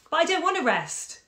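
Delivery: high, loud, petulant retort; exasperated protest. A narrator's reading. Mother refusing daughter's order to rest, exasperated protest.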